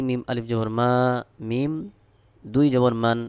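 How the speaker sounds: normal talking voice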